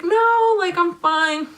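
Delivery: high, drawn-out "no" of refusal; retold protest. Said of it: "So I start crying".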